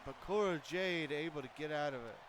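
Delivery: excited wrestling commentary; live play-by-play. Acted. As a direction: call the match like a ringside announcer.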